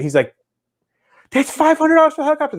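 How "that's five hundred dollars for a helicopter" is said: very high, rushed incredulous voice; high-pitched squawk of disbelief at the price; mock astonishment. Buyer amazed at the helicopter price, astonished.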